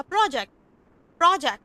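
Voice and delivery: प्रोजेक्ट said with the final t barely touched; soft final t. Shown as a demonstration. Teacher shows students how to end प्रोजेक्ट. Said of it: "टी को मैंने slant रखा है".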